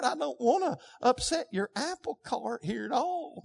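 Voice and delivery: soft, hesitant, apologetic hedging; timid mock suggestion. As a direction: tiptoe around it, afraid to offend. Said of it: "It's not this".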